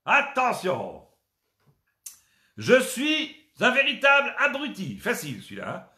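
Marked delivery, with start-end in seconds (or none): 2.6-5.0 proud silly announcer cry; shouted catchphrase. Acted. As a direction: belt out the game catchphrase.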